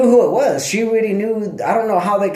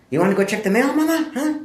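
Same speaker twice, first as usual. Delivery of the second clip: put-on high, sing-song baby talk; high cooing voice asking the dog out; 9 semitones higher than usual. Talking sweetly to the dog.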